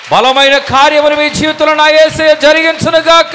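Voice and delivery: high, loud declaration of blessing; full-voice proclamation. Real, not acted. Preacher calling a blessing over the congregation, loud and high. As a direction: ring it out over the whole church.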